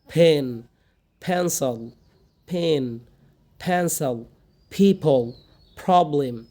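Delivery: each p released with a puff of air; aspirated P words. Shown as a demonstration. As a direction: model words slowly, puffing every first P. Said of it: "কিন্তু উচ্চারণ হবে কি".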